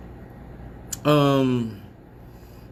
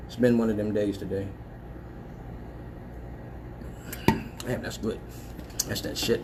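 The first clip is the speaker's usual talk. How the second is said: talking between sips; quiet, after a swallow; while sipping a drink; 4 semitones lower, 8 dB quieter than usual. Real, not acted. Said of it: "I'm drinking".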